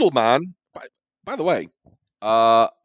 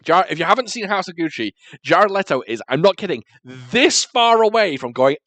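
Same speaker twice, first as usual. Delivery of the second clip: ordinary delivery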